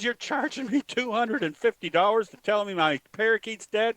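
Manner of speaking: outraged lady. Retold or imitated. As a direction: protest the bill in a lady's voice. Joke: lady outraged at bill.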